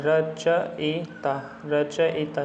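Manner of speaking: रचयिता drawn out slowly, ra-cha-yi-taa, falling; slow spelling-out. Shown as a demonstration. Teacher to students drilling the right spelling, very slow. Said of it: "रचयिता होता है".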